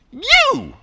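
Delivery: sudden high, punchy call-out; spring the answer on them; emphatic exclamation. Acted. Teacher springing the answer on students, high and emphatic. Revealing the source of anger.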